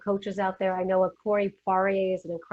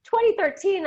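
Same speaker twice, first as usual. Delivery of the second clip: surprised; 10 semitones higher, 4 dB louder than usual. Retold or imitated. Discovering the date on article.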